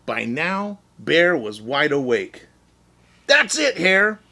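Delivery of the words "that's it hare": high, loud angry yell; hollering. Acted. Wide-awake Bear hollering at Hare. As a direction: Bear has had enough. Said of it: "he hollered".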